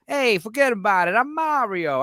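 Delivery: breezy New York Italian American Mario; Italian American accent. Retold or imitated. Imitating Mario's movie voice in a light Italian American accent. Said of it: "an Italian American accent".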